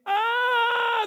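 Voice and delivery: sudden high squawk of panic; high yelp of fright. Acted. Eaglet clipped by mum's wing, yelping in fright. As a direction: yelp like a chick knocked off balance.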